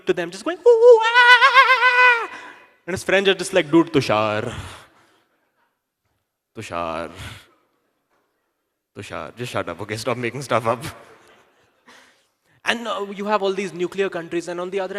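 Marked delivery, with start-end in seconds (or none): 0.7-2.3 very high, loud monkey screech; frantic monkey shrieks. Acted. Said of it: "he would be going mad explaining". 3.7-10.9 low, weary voice with long pauses; dismissive monkey friend. Acted. Monkey's friends dismissing his tale.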